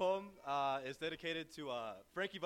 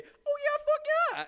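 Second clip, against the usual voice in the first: much higher, louder squeal of agreement; Sugar's eager yes; 10 dB louder than usual. Retold or imitated. Sugar answering any question, hammed-up gleeful yes. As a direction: play the hard-drinking buddy gleefully agreeing. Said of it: "he'd say".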